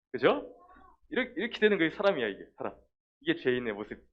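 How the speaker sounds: normal talking voice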